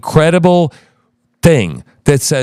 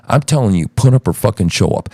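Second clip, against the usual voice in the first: usual speaking voice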